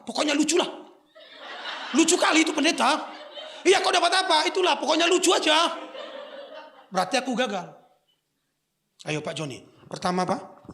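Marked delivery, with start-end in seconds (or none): 0.0-5.7 higher, louder laughing churchgoer; a lightly played churchgoer going on about the funny pastor; lightly voiced churchgoer. Mock family chat after service. (jemaat ditirukan ringan, tinggi dan tertawa)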